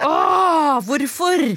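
high, loud inner groan; realizing the mistake, aghast; dismayed realization. Retold or imitated. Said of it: "kjente jeg bare sånn".

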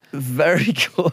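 slow, laughing tease; teasing mimicry. Teasing the other impressionist mid-explanation, mocking mimicry. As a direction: draw it out mockingly through a laugh.

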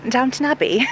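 a touch of posh period-drama English; posh accent. Shown as a demonstration. Acting student asked for received pronunciation, posh accent. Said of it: "a posh accent".

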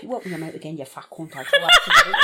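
mock mother threatening her child; imitating an irate mam. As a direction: play a mammy hissing threats at her kid, then crack up. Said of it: "how your ma speaks to you".

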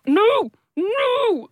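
high, drawn-out cartoonish no; mock refusal. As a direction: squeal a stretched-out refusal. Acting out refusing to admit feelings.

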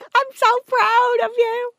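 high, slow, drawn-out gushing; proud mother. Retold or imitated. Friend beaming walking back, proud-mother gush. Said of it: "I was like a proud mother".

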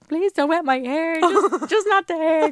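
high, drawn-out pleading wail; mock drunken pleading. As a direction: plead slowly, voice pitched right up. Retold drunken plea in shower.

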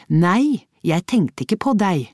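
hard. A narrator's reading. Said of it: "svarte hun hardt".

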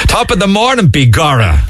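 high, hammed-up Irish brogue; over-the-top fake Irish greeting; mock stage Irish. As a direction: parody a Hollywood Irishman in a high lilt. Host parodies stage-Irish greeting, laughing.

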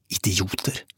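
soft, contemptuous hiss; angry hiss. A narrator's reading. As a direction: hiss the insult under your breath. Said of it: "hveste Kåre".

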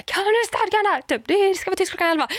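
hammed-up irate neighbour, shrill-high and quick; mock scolding neighbour. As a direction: bark the rule at her like the furious neighbour.

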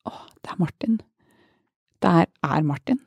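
hushed, startled whisper to herself; shocked whisper. Retold or imitated. Sees her old friend boarding, startled whisper. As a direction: breathe it out in shock. Recognizing him on plane.

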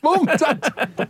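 very high northern English parody, laughing; mock northern accent. Host joining his own gag, high mock northern accent. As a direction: pipe it up in a silly northern accent.

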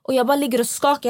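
ordinary talk

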